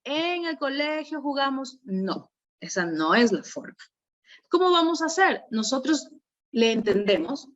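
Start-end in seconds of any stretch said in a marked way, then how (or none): 0.0-1.8 mock stern correction, pitched high and soft; scolding teacher. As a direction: play the impatient teacher scolding a child. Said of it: "no le voy a decir".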